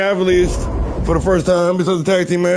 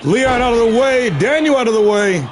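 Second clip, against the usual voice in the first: play-by-play call; 4 semitones higher than usual. Acted. Streamer calling his own wrestling match, play-by-play. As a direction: call the action like a hyped commentator.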